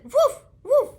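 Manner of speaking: very high, loud happy woofs; two big yappy barks; mama dog barking. Acted. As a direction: bark back in a high voice.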